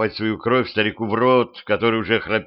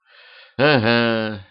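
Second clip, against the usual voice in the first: a slow, grudging grumbled "Ага"; grumbling; much slower than usual. A narrator's reading. Queen grumbles back at the vampire, slow and grudging. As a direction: drag it out, lightly grumbled. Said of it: "проворчала королева".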